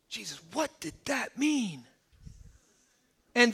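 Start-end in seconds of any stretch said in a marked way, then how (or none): 0.1-1.8 hushed, put-on puzzled question; mock confused aside. Followers privately asking Jesus to explain, hushed mock confusion. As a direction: sheepishly ask on the quiet.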